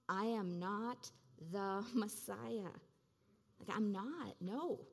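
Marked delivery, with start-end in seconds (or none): 0.1-2.7 slow, each word laid down for the congregation; slow unison declaration. Shown as a demonstration. Preacher leading congregation to repeat, slow and deliberate. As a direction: lead the room through it word by word. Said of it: "You want to just say that with me right now?".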